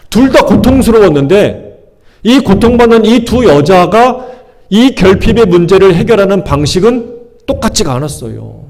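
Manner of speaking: normal talking voice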